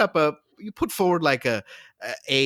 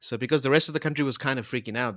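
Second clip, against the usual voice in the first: usual speaking voice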